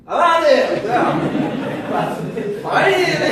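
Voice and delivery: hammed-up nervous Abraham, half laughing; imitating Abraham urging God through laughter; laughing mock Abraham. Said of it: "eu imagino Abraão assim".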